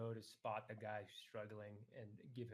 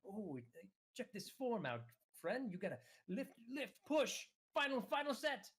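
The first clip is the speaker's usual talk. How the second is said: high, pumped-up gym coach voice; gym bro voice; 11 semitones higher than usual. Acted. Player's character coaching the struggling goblin, pumped and high. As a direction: cheer him on like an overeager spotter. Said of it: "I'm just kinda running in, like".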